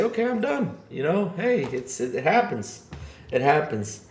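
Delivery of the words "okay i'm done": PC giving up. Acted. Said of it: "this thing is gonna say".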